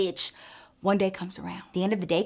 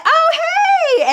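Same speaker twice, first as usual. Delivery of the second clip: very high, loud oh hey; excited greeting; 9 dB louder than usual. Retold or imitated. Spotting her friend Samantha, excited high greeting. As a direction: squeal a happy hello.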